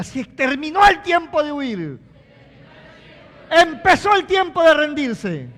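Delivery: now say it like you mean it; convinced shout. Shown as a demonstration. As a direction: proclaim it with full conviction. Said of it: "Usted no está muy convencido de eso".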